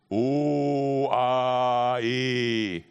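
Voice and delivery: O, A, E drawn out very slowly, almost chanted; long held vowels. Shown as a demonstration. Preacher showing what vowels are, O, A, E long and sustained. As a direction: hold O, A and E each on a long steady tone. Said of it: "unsere Sprache lebt von Vokalen".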